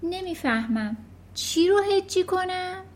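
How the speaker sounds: put-on puzzled boy. Confused boy asks.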